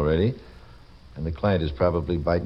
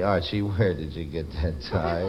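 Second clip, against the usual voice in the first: subdued, mock-sorrowful question; sad; 4 dB quieter than usual. Shown as a demonstration. Host demonstrating a mood for the audience, sad. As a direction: ask it sadly, as a comic demonstration.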